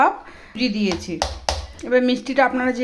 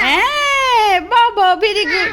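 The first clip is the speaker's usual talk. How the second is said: delighted praise; 10 semitones higher, 6 dB louder, much slower than usual. Real, not acted. Mother praising toddler. (উচ্ছ্বসিত আদুরে প্রশংসা)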